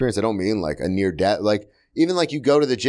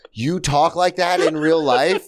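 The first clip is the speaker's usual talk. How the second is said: higher, drawn-out disbelief, rising at the end; incredulous question; 5 semitones higher, slower than usual. Retold or imitated. Waiter imagines asking a star, drawn-out incredulity. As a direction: lean in, amazed and amused. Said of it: "I really wanted to lean in and go".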